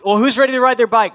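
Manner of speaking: high, loud, eager invitation; rally the kids with big enthusiasm; excited dad. Retold or imitated. Excited dad addressing his kids.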